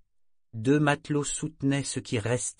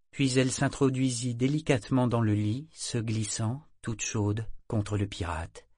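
ordinary delivery

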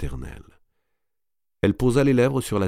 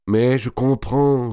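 higher, louder, drawn-out sympathy; insistent consoling; 6 semitones higher, 5 dB louder, much slower than usual. A narrator's reading. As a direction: stretch it out, pressing her to listen. Madame de Bouville veut consoler Marie. (consolation insistante)

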